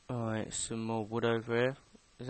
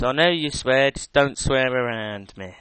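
stern mum; 7 dB louder than usual. Retold or imitated. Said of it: "She says".

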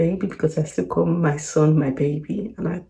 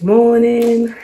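slow, higher and louder; drawn-out announcement; 5 semitones higher, 7 dB louder than usual. Real, not acted. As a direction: draw it out like a groan. Mock-groggy morning announcement.

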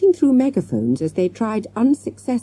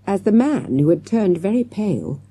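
ordinary delivery, nothing marked

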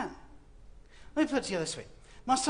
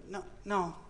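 ordinary talk

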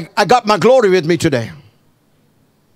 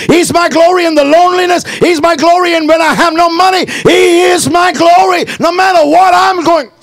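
high, loud, rhythmic pulpit shout; climactic, fervent preacher's cry; full-force preaching; 10 semitones higher, 4 dB louder than usual. Real, not acted. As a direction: whip the congregation up with driving repetition.

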